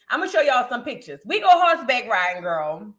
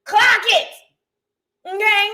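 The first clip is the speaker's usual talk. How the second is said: higher, slow sassy gloat; 7 semitones higher, slower than usual. Retold or imitated. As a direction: savour every word, rubbing it in. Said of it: "I turned around and said".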